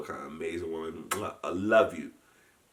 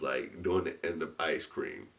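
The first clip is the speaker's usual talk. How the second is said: ordinary talk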